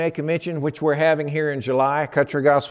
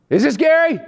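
higher, more insistent repeat of the question; unknown caller insisting; 11 semitones higher, 3 dB louder than usual. Retold or imitated. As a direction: ask again pushing harder, voice up high. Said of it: "this person says".

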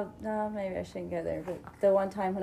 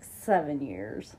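slow, lower, proud reply; proudly; 8 semitones lower, 4 dB quieter, much slower than usual. Retold or imitated. Answering a stranger, slow and proud. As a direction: say it with pride, drawn out. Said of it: "Very proudly".

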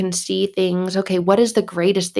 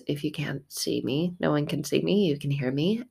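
much softer, tossed-off side remark; quiet aside; 3 semitones lower, 9 dB quieter than usual. Real, not acted. Speaker quietly telling listeners they can only hear her. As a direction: drop away for a quick aside.

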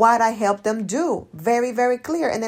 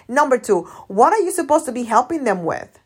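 ordinary talk, nothing marked

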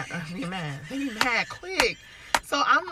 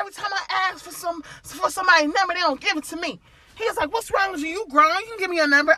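higher hammed-up complaining man; pitched-up imitation of annoyed man; mock frustrated suitor; 8 semitones higher, faster than usual. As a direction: mimic his whiny complaint, high.

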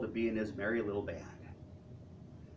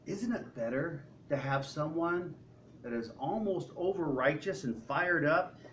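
usual speaking voice

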